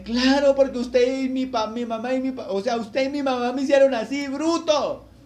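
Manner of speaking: a son finally talks back to his insulting father, loud and hurt; retold crying retort. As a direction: shout back at the father, close to tears. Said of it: "yo me puse a llorar y le dije".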